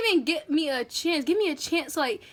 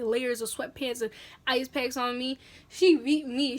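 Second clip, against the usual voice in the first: ordinary talk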